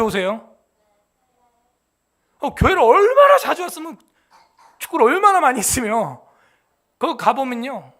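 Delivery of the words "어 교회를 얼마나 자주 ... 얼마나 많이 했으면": much higher, louder, amazed; stunned disbelief, raised high and loud; astonished exclamation. Real, not acted. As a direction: marvel at it, pitch jumping up. Same exclamation, pitched much higher.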